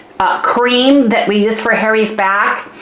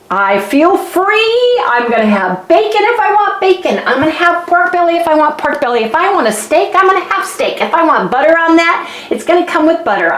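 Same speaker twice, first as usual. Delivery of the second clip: high, quick excited declaration; 7 semitones higher, faster than usual. Real, not acted. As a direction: burst out with gleeful defiance. Declaring freedom from strict keto.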